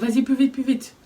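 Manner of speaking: high, loud urging. Retold or imitated. Italian helper urging her up the stairs, loud. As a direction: push her to hurry.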